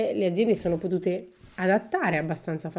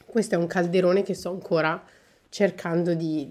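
ordinary talk

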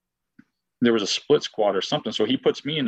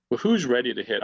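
ordinary delivery

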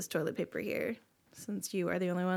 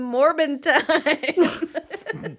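meme catchphrase; 3 semitones higher, 5 dB louder, much slower than usual. Pretend.

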